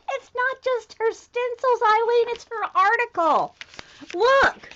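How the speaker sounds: high, loud, giddy insistence; giddy, high-pitched fan delight; laughing excitement. Real, not acted. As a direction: burst out with a laugh, thrilled. Excitedly tells Eileen about the find.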